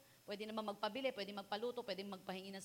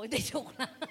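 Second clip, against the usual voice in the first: laughing through the words; real laughter, louder; 5 semitones higher, 10 dB louder than usual. Real, not acted. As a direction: crack up mid-sentence. Laughing at own story.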